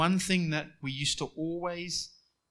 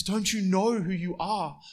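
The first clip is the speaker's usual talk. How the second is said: voicing God; 5 semitones higher, 7 dB louder than usual. Preacher voicing God's appeal.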